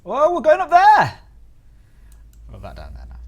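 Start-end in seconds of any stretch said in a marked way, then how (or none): 0.0-1.2 excited. Real, not acted. Camera zooming, excited exclaim.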